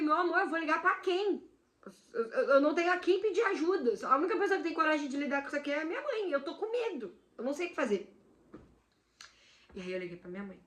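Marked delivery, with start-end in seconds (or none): 0.0-8.1 higher, upset, on the edge of tears; frightened girlfriend pleading through tears; tearful pleading. Retold or imitated. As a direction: plead with him, scared and crying. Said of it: "eu comecei a chorar".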